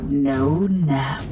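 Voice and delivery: ordinary delivery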